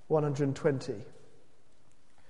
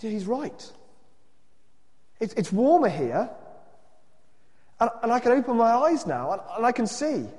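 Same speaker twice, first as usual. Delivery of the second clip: higher-pitched, halting discovery; dawning wonder; 6 semitones higher, 3 dB louder than usual. A narrator's reading. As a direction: stumble into it, amazed. Cave man discovers the warmth.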